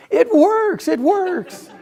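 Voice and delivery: high, excited it works, said twice; a delighted cardiologist brother marvelling; amazed brother. Retold or imitated. Brother laughing that the new device works, high and amazed.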